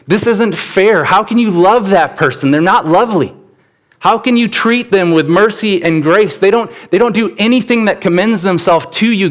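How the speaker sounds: lightly hammed-up objection; mock indignant complaint. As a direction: imitate someone protesting unfairness to God. Voiced complaint to God about fairness.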